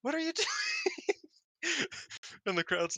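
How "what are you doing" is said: very high mock squeal, breaking into laughter; upset disbelief. Retelling the partner's reaction to the forgotten bit, mock upset. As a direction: imitate an appalled partner, pitched way up. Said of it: "everybody was upset about it".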